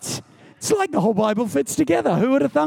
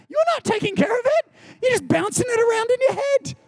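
mock whiny worrier; 14 semitones higher than usual. Mocking the anxious self.

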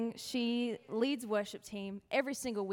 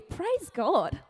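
higher, in disbelief; incredulous praise; 4 semitones higher than usual. Retold or imitated. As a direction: ask it as if it made no sense. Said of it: "would your first thought be".

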